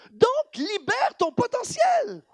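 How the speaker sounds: slow, high-pitched rallying cry; preacher's shout. Real, not acted. Preacher urging congregation to free their potential, shouting. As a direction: cry out the command, drawn out.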